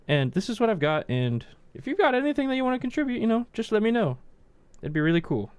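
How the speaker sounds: put-on polite kid. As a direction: chirp it like a well-mannered kid.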